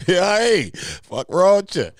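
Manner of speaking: gleeful laughing punchline; excited laughing tease. Real, not acted. Host laughs while roasting Lena with his dingo joke, excited.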